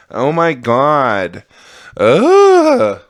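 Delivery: drawn-out oh my God into a high wail; slow, swelling lament, hammed-up; Christine Baskets. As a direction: stretch it out, then cry out high and loud. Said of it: "That's like Christine Baskets".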